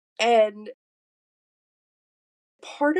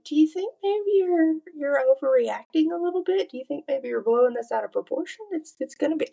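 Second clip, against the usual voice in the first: higher-pitched, mock-reasonable questioning; imagined doubting self-talk; 8 semitones higher, faster than usual. Questioning her own overreaction as it might have happened, mock-reasonable. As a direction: act out a coaxing inner voice, pitched up.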